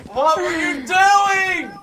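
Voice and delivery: slow, high, stretched-out yell of disbelief; exasperated shout. Real, not acted. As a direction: drag it out, pleading and furious. Caster yells at player's throw.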